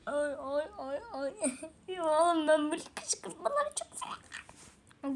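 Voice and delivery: breathless laugh with a whispered crying edge; laughing to tears. Real, not acted. To her friends, laughing herself to tears over the provocations. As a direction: laugh until it turns to a teary whisper.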